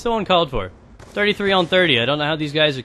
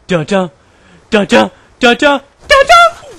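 chanted "dun dun", climbing higher and louder to a squeal; building suspense sting; 5 semitones higher, 9 dB louder than usual. Pretend. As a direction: ramp up a mock dramatic sting. Mock suspense sting, rising each time.

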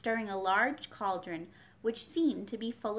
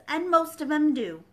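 higher, louder blunt retort; the Duchess brushing Alice off; 6 semitones higher, 8 dB louder than usual. A narrator's reading. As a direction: slap it down bluntly.

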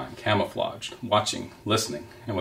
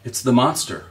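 raised, alarmed owl cry; a touch of a screech, higher than usual; mild screech; 4 semitones higher, 4 dB louder than usual. A narrator's reading. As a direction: sound the alarm, without full screaming. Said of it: "Swooper fluttered up from his branch and screeched".

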